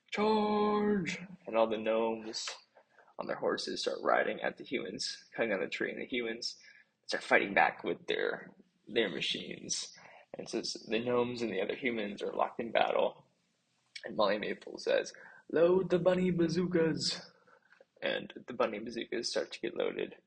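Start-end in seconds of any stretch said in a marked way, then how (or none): 0.1-1.2 high, loud charge call; rally the troops with one cry; king on horseback yelling the charge; battle cry. Acted. King leads cavalry charge. 15.5-17.2 high, loud battle command; barked order. Acted. Commander orders bazookas loaded.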